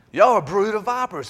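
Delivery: high, forceful prophet's rebuke; John the Baptist rebuking. Retold or imitated. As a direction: hurl the accusation at the Pharisees. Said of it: "That was bad talk back in those days".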